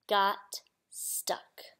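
low, slow near whisper; hushed suspense. A narrator's reading. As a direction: break the bad news very softly. Suspense in story read aloud.

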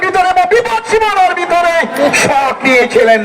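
high-pitched excited commentary; frantic football commentary. Real, not acted. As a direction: voice shoots up as the shot comes. (উত্তেজিত football ধারাভাষ্য)

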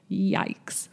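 ordinary talk, nothing marked

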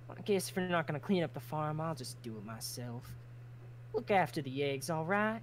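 Southern line sliding British; slipped into British. Acted. Tally sliding from Southern into British mid-line. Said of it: "You're making British".

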